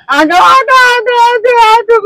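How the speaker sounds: high, loud mocking yell of auto, auto; mock shout for an auto. Husband mimicking wife calling autos in rain, high mock yell. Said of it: "ही ओरडते मुद्दामून".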